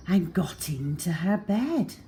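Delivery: ordinary delivery, nothing marked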